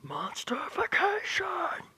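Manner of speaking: slow, high and loud; powering up mid-run with a drawn-out shout; battle cry. Acted. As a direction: bellow it as he powers up.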